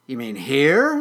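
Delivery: loud, rising incredulous question; taken aback. Acted. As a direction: ask in loud disbelief. Weedle taken aback by kitten.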